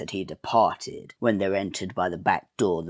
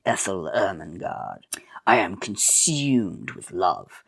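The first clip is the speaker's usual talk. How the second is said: whispered leering villain; whispered villain courting; sinister whisper. Acted. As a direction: breathe it like a lecherous squire.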